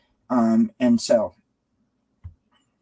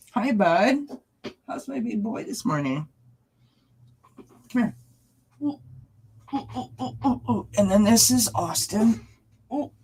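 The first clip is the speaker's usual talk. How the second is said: much higher coaxing voice for the cats; pet talk; 10 semitones higher than usual. Real, not acted. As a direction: sweet-talk the cat to come up. Cooing at cats.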